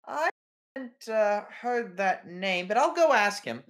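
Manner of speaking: high, loud, chirpy receptionist voice; front desk clerk. Acted. Receptionist greeting visitors at the newspaper front desk, high bright voice. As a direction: greet them like an eager receptionist.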